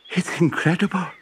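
high, halting amazed wife; stammering wife. A narrator's reading. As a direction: stumble over the words in shock. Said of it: "stammered Missus Popoff".

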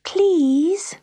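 PLEASE high and breath-filled, rising; begging. A narrator's reading. Pat begging the silent grand piano, PLEASE pleaded high. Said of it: "begged Pat".

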